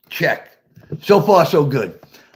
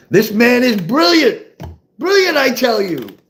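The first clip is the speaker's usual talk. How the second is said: higher-pitched, marvelling declaration; mock awe; 6 semitones higher than usual. Son marvelling that dad's advice worked, hammed-up awe. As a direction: proclaim a genius with rising wonder.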